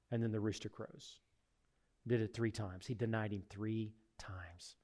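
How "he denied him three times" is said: slow hushed whisper. Real, not acted. Preacher whispering the denial to the congregation. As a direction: let it sink in, barely voiced.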